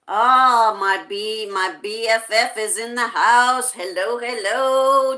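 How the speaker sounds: loud, delighted welcome; excited greeting. Real, not acted. Host greets best friend arriving in the stream, excited greeting. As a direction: burst out as you spot your best friend.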